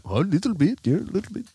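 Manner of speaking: high, playful disguised voice; put-on prank voice. Admitting it to the angry scammer, high put-on voice. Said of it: "female voice".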